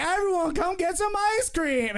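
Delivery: high, chirpy invitation; cheery Doug. Retold or imitated. Doug invites the room for ice cream, high and cheery. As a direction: call everyone over like a happy dad. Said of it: "is like".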